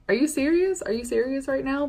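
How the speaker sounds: high, loud disbelief; are you kidding me, outraged; incredulous. Real, not acted. Outraged rant at commenters.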